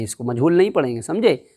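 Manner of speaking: normal talking voice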